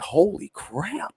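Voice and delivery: slow, high, hushed amazement; awed gasp. Retold or imitated. Watching the high roller lose, hushed and stunned in slow awe. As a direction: breathe it out, stunned by the losses. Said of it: "I'm getting emotional".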